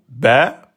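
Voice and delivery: "ba" on one beat, rising; short rising "ba"; short vowel. Shown as a demonstration. Teacher timing one pen stroke, short "ba". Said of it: "Un temps, je monte le stylo".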